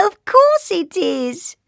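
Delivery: high, loud giggly reply; giggling. A narrator's reading. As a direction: giggle the words out with joy. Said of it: "she giggled".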